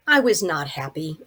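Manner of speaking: ordinary delivery, nothing marked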